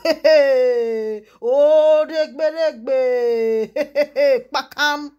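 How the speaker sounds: loud laughing cries of hey. Real, not acted. Laughing out loud at Seun's fight with the police, hooting hey. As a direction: burst out laughing and shouting hey.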